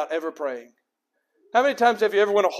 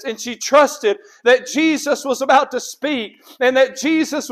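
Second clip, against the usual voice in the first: forceful preaching; 4 semitones higher, 5 dB louder than usual. Real, not acted. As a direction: preach each phrase with conviction, raised voice.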